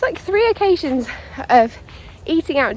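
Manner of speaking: ordinary talk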